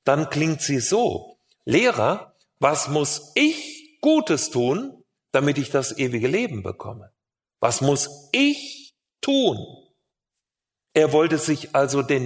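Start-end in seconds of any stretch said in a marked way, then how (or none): 1.7-9.6 "ich" drawn out and punched; stress on ICH. Shown as a demonstration. Preacher re-stressing the young man's question, stress on "ich". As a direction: lean hard and slowly on "ich". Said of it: "Man muss diese Frage nur einmal anders betonen".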